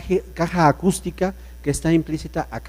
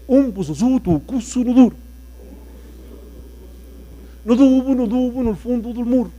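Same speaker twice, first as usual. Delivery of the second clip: gibberish voice; 6 semitones higher than usual. Shown as a demonstration. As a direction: sell the nonsense with a strange high voice. Nonsense gibberish demo of voice. (galimatías con voz aguda y extraña)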